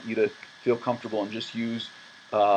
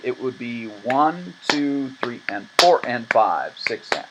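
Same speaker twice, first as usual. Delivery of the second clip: "one" and "four" punched in a six eight count; teacher counting six eight with accents; stress on one and four; 3 dB louder, slower than usual. Shown as a demonstration. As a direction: lean on the beats one and four. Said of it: "the emphasis is on one and four".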